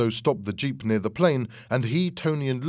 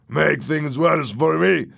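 loud, slow, high echo of disbelief; drawn-out indignant yell; incredulous shout; 5 semitones higher, 6 dB louder, slower than usual. A narrator's reading. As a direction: throw it back, outraged. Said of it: "shouted Louis".

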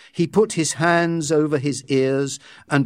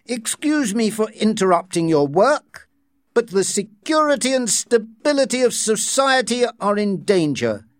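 raised pitch, formal and commanding; stern director voice; 10 semitones higher than usual. Acted. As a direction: address the room like a stern official. Director addresses the workers.

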